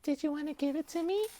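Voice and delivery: put-on high girly voice from a man; man doing a woman's voice; girl voice. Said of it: "Do your girl voice".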